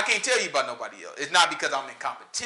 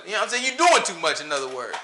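normal talking voice

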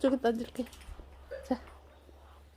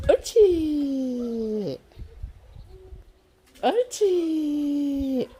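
slow loud drawn-out praise; talking to a dog; 5 semitones higher, 6 dB louder, slower than usual. Real, not acted. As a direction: stretch it out for the dog.

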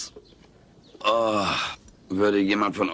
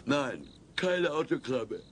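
slow, softer, drifting into sleep; fading off to sleep; 5 semitones higher, 7 dB quieter than usual. Acted. Alf mumbling as sleep takes him, slow and soft. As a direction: let it trail off as he falls asleep.

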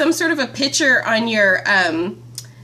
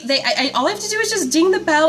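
normal talking voice

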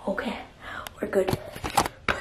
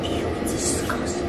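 soft, secretive whisper; disgusted whisper; 6 dB quieter than usual. Acted. Vlogger hiding in a grubby spot, disgusted whisper.

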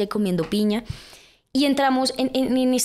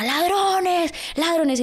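imitated shout; 10 semitones higher, much slower than usual. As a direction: yell it after the fleeing kids. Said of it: "empezó a gritar la señora". (grito imitado de la señora, mucho más agudo)

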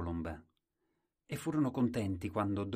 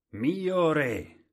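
loud, higher call; loud and clear; 8 semitones higher, 10 dB louder than usual. Acted. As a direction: announce it to the whole court. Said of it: "disse chiaro e forte".

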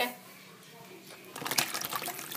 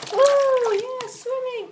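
excited; 9 semitones higher, 4 dB louder, much slower than usual. Pretend. Toy about to jump.

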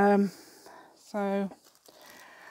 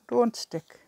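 lightly put-on Irish accent, higher at first; 4 semitones higher, slower than usual. Shown as a demonstration. Crafter showing viewers her Irish, lightly put-on accent. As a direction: have a go at "don't stick" in Irish. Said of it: "That's, that's Irish".

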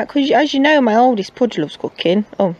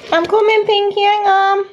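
calling out; 12 semitones higher than usual. Real, not acted. Calling to child in another room.

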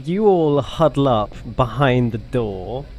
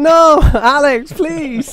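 loud, high, drawn-out begging; laughing plea; 14 semitones higher, 8 dB louder, much slower than usual. Real, not acted. Player begging Alex after being volunteered, laughing plea. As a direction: beg at the top of your voice, half laughing.

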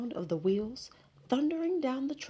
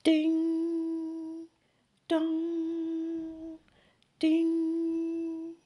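much higher, louder, drawn-out bell tones; chiming bells; 10 semitones higher, 4 dB louder, much slower than usual. Acted. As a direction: ring each word like a church bell. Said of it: "chiming of the bells".

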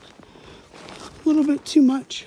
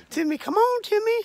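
calling out; 10 semitones higher than usual. Pretend. Calling for absent friend.

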